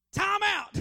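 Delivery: high, loud overwhelmed outburst; exasperated shout. Retold or imitated. Tired dad to daughter, loud exasperated shout. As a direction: throw up your hands and yell it. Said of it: "And I'm just like".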